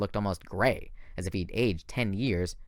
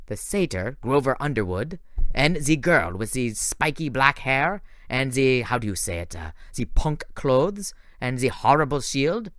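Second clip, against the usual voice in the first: put-on French accent; 2 semitones higher than usual. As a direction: drag out the list in a French accent. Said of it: "weird French accent".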